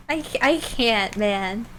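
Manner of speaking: ordinary talk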